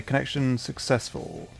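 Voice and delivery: ordinary talk, nothing marked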